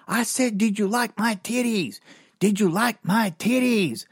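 lady's voice. Retold or imitated. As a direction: push the question on him like the lady. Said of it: "So I was going".

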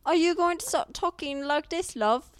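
mock British accent. Cohost teasing her friend about talking like this, mock British accent. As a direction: tease in a hammed-up British accent. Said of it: "basically convince people that you are British".

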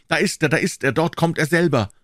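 high, excited cries from a crowd; calling out. A narrator's reading. Onlookers in the crowd spot the suspect, excited calling out. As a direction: call out as you spot him coming. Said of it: "einzelne Stimmen riefen".

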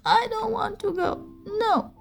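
put-on very high, childish half-sob. Girl refusing kindergarten to parent, high half-sobbing whine. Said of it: "she half sobbingly said".

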